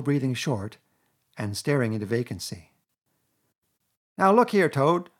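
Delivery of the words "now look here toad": high, loud and sharp. A narrator's reading. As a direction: cut him short like a scolding. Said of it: "said the rat sharply".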